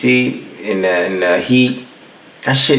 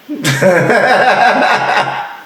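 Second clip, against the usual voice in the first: breathy laugh; 7 semitones higher than usual. Real, not acted. Laughs at the pickles line.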